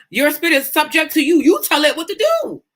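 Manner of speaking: high-pitched, passionate preaching; impassioned near-yell. Real, not acted. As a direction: drive it home, pitch climbing high. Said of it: "If I sound like I'm yelling".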